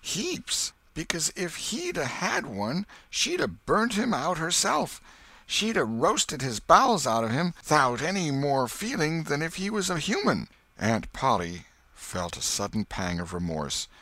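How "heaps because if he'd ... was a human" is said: put-on high boy's voice, earnest; a kid making his case; boy arguing. A narrator's reading. Boy argues cruelty to his aunt, high and earnest.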